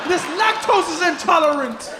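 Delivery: very high, slow squeal of shock; hammed-up scandalised cry; mock horror. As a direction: exclaim aghast, stretching the words. Acted shock at a product label.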